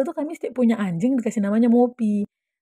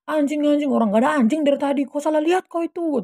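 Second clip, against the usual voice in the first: high, louder mock scolding; exasperated contradiction; 7 semitones higher, 4 dB louder than usual. Friend insisting rider saw wrong, exasperated high retort. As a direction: argue back heatedly as the retold friend.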